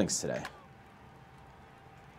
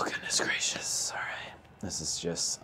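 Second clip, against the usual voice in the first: slow whispered exasperation; 7 semitones lower, 14 dB quieter than usual. Real, not acted. Player whispering in frustration at his bad at-bats. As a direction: breathe it out, fed up.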